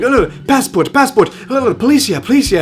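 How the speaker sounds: half-hearted retold yell; imitated yelling. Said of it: "just starts yelling in Greek".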